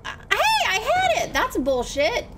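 very high, indignant squawk; indignant protest. Real, not acted. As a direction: protest shrilly at the game cheating you. Protests a failure in game.

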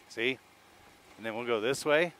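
usual speaking voice

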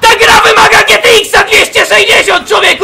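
high, screamed rant, easing at the end; outraged yelling. Real, not acted. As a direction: scream in exasperation at the game. Outraged rant about game lag.

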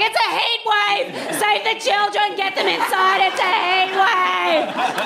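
high, fretful mock panic; mock panicking Brits. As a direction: play a Londoner flapping about the heat. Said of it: "Everyone's like".

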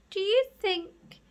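high, slow tentative question; hesitant, breaking off. Acted. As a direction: timidly start asking, then break off. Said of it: "then his voice broke off".

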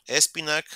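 espinac said with a hard K ending; spinach pronunciation shown. Shown as a demonstration. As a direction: say espinac ending on a crisp K sound. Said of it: "que es normalmente la C y la H, en inglés es una K".

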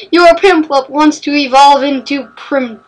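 ordinary talk

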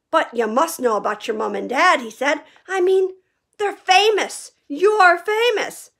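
stunned disbelief; astonished Hagrid. A narrator's reading. Hagrid amazed Harry doesn't know his fame.

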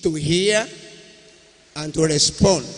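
ordinary delivery

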